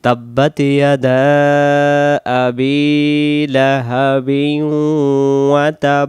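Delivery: drawn-out melodic recitation; chanted Quranic recitation; slow Quran recitation. Shown as a demonstration. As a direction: recite it slowly and devoutly. Recites verse slowly.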